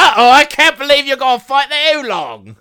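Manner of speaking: put-on loud Australian accent. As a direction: belt it out as a jokey Australian bloke. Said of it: "I'm doing a perfect Australian accent".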